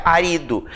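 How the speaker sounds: "arido" stressed on RI; accent removed. Shown as a demonstration. Teacher shows what remains without the accent, stress shifted to RI. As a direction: move the stress of "arido" to the middle syllable, a-RI-do. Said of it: "Se eu tiro o acento, o que que fica".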